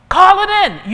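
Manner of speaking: high, loud preacher's call; emphatic call. Real, not acted. Preacher repeats the urging call.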